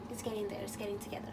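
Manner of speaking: ordinary delivery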